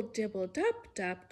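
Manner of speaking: ordinary delivery, nothing marked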